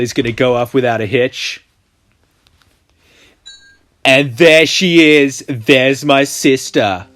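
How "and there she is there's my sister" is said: slow, loud big reveal; dramatic reveal. Acted. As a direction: announce her like a grand discovery.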